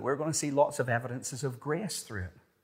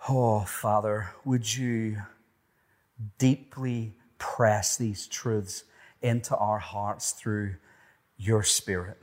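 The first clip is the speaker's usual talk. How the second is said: slow, earnest prayer voice; 2 semitones lower than usual. Acted. Pastor praying over the congregation, earnest. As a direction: pray it sincerely, unhurried.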